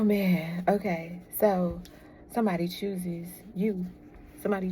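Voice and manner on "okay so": ordinary talk, nothing marked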